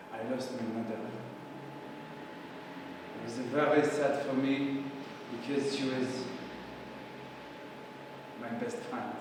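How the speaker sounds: low, hushed and halting grief; sorrowful. Acted. Louis recalls losing his mother, acted sorrow. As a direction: recount the loss quietly, with long pauses.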